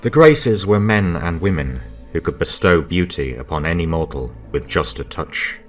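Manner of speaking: ordinary delivery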